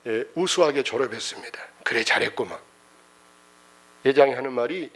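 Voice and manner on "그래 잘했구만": hushed, breathy praise from the chairman; low whispered well done; whispered approval. Retold or imitated. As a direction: lean in and whisper it.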